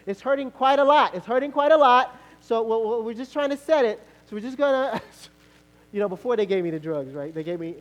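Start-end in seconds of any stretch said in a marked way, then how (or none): 0.1-5.0 higher, louder mock paramedic patter; hammed-up medic talking through it. Paramedics setting his ankle, louder mock-calm patter. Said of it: "They were like".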